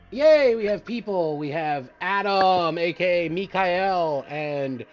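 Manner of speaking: loud, slow cheerful greeting; excited welcome. Real, not acted. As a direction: announce them with glee.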